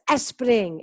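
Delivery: "spring" begun with a vowel, "es-pring"; wrong learner pronunciation. Shown as a demonstration. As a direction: stick a Spanish e before the s of spring. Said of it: "Y no es spring". (spring mal dicho, con e inicial: "espring")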